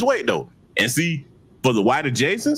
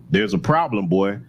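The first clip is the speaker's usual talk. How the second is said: ordinary talk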